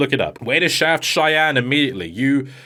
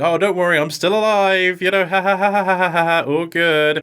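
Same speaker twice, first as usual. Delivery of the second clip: chirpy, carefree mock laugh a bit higher; mock Cosmo; 6 semitones higher than usual. Mocking the dub's Cosmo reassuring Sonic, light and carefree. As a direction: play her as breezily unbothered.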